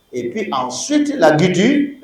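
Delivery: normal talking voice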